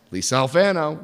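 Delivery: ordinary talk, nothing marked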